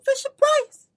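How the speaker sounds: very high, loud squeal; squeaky high voice. Pretend. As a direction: squeal it like a toddler toy ad. Sudden squeaky jingle voice.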